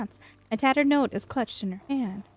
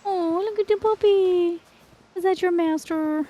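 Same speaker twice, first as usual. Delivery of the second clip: cooing at a puppy; 7 semitones higher, 4 dB louder than usual. Real, not acted. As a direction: coo at the puppy like a pet owner. Talking to a dog in game.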